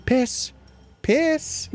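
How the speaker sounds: high coaxing call. Retold or imitated. Owner coaxing a boy dog to pee, high sing-song. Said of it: "it's just gonna be like".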